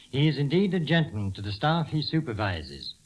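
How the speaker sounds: ordinary delivery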